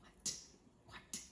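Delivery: barely audible whispered disbelief; stunned. Retold or imitated. Applicant told her visa is approved, stunned whisper. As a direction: whisper it, stunned, with a pause between.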